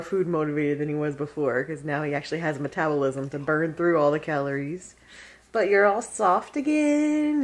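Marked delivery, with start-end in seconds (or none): 5.5-7.4 high, slow coo; lightly sweet voice for the dog; pet talk. Real, not acted. Praises the dog's fur.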